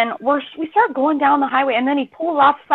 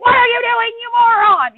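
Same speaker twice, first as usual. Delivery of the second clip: high, loud mock-angry shout; yelling at husband for the detour; exasperated yell; 12 semitones higher, 5 dB louder than usual. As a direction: scold him loudly like a fool. Yelling at fiancé in retold story.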